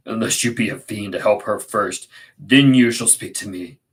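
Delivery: forceful but controlled; stern demand. Acted. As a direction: order him to help her first. Edgar confronted with unconscious wife.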